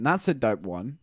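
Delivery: ordinary talk, nothing marked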